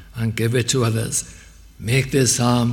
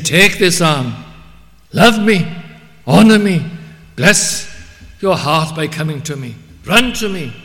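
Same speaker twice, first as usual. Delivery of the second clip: much higher and louder fervent plea; voice of God calling; 6 semitones higher, 9 dB louder than usual. Acted. Preacher voicing God inviting the congregation, loud and high. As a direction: cry out the Lord's invitation with full force. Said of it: "He's saying".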